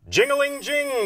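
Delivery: high, ringing phone imitation; sing-out, high ringing sound; ringing telephone. A narrator's reading. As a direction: trill it like a telephone bell. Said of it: "the telephone rings".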